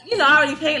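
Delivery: ordinary delivery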